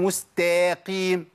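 مستقيم slow with an added long ا after the ت; مستقيم drawn out as مستاقيم; overstretched vowel. Shown as a demonstration. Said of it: "جبت بعد التاء ألف". (مستقيم بألف زائدة بعد التاء)

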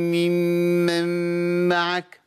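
ممن معك with all the m sounds blurred into one hum; wrong merged m. Shown as a demonstration. Teacher showing the wrong merged reading of ممن معك. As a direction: run the m's of ممن معك together in one long buzz. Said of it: "ده خطأ".

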